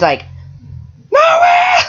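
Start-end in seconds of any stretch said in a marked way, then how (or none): 1.1-1.8 very high, loud shriek of delight; excited squeal. Acted. She hears there is a narwhal, thrilled shriek. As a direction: squeal it at the top of the range.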